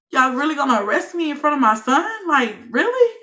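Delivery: high, loud and fast, indignant; outraged disbelief. Retold or imitated. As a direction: demand an answer, stunned and furious. Outraged at arrest before son.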